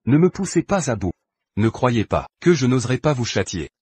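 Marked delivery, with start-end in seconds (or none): 0.1-1.1 angry warning. Acted. Husband threatens the scheming rival.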